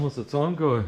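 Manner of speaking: ordinary talk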